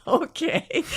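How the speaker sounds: high, uncertain okay; baffled compliance. Retold or imitated. Client answering a puzzling order, baffled and high. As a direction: go along with it, unsure.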